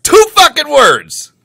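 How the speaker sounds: slow, high, very loud shout; drawn-out mock fury; exasperated yell. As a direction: holler each word out. Exasperated at missed cue.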